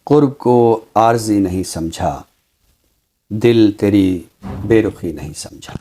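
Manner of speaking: measured, unhurried reciting of a couplet; ghazal recitation. Acted. Poet recites his ghazal for the host, lingering and measured. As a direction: lift the opening line like a poet at a mushaira. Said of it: "गज़ल आज आपने अता की".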